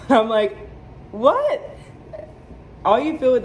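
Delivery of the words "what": a very high disbelieving what; shocked what. Retold or imitated. Mother hearing the babies are out, high shocked what. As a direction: squeak it out in disbelief. Told babies coming out now.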